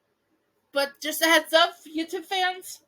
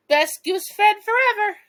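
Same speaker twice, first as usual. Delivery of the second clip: playful; 6 semitones higher, 7 dB louder than usual. A narrator's reading. Skye teasing, chuckling.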